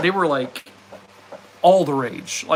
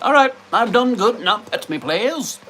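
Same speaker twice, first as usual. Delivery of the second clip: lightly voiced dog, pitched up; a hint of a proud pup demanding pets; dog's voice; 8 semitones higher, 4 dB louder than usual. Voicing Charlie the dog asking for pets, lightly pitched up. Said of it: "He's like".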